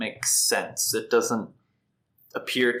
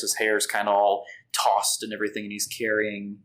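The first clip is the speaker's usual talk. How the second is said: normal talking voice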